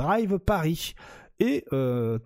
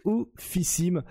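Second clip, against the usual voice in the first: ordinary talk, nothing marked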